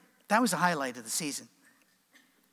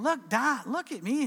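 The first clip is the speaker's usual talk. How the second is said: put-on high-pitched boy calling to Dad; excited little boy; 7 semitones higher, slower than usual. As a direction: imitate a kid showing off to his father. Young son calls proudly to dad.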